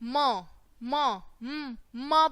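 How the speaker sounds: म as a slow, drawn-out "muh", with a hum; phonics sound. Shown as a demonstration. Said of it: "एम की sound कैसे निकलेगी". (म को धीरे, खींचकर बोलें)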